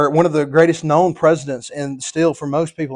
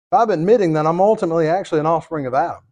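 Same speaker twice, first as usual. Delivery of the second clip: ordinary delivery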